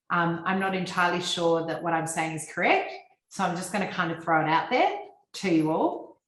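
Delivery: every phrase lifts at the end like a question; unsure Australian upspeak, rising on final words like "Coraggio" and "all"; high end inflection. Shown as a demonstration. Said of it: "the high end inflection is when we say".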